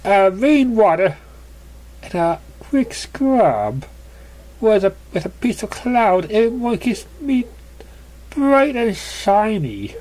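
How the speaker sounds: breezy dismissal. Acted. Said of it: "waving the front hoof dismissively".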